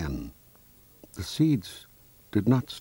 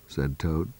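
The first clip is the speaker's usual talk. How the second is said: ordinary delivery, nothing marked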